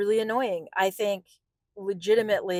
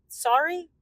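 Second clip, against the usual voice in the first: mock sorry; 9 semitones higher than usual. Quoting rep's apology.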